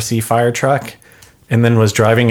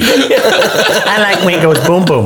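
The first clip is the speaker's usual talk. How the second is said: put-on high, slow babyish whine; big baby voice; 8 semitones higher, 4 dB louder, slower than usual. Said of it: "He talked like a big baby".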